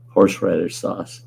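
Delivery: normal talking voice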